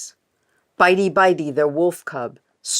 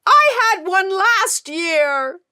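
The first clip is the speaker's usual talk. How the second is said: high, loud, slow whine; childish protest; 9 dB louder, slower than usual. Acted. Dirty Ulf arguing with her mother, high whine. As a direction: plead like a stubborn child.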